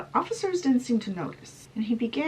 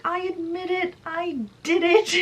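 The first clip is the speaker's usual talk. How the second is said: high, frantic confession, growing louder; yelled confession; 10 semitones higher, 4 dB louder than usual. Acted. Murderer confessing to the officers, frantic high yell. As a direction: blurt it out high, swelling to a yell. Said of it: "he just yells out".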